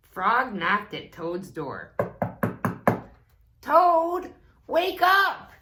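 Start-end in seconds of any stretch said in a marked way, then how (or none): 3.6-5.5 high, loud wake-up call; crying out. A narrator's reading. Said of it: "he cried".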